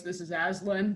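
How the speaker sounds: Aslan. Shown as a demonstration. Player showing the few voices they can do, deep voice. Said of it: "a deeper version of my normal voice".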